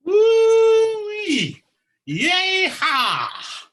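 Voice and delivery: high whooping yeehaw; farmer whoop. Acted. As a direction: holler it like a thrilled farmer.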